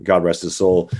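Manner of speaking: normal talking voice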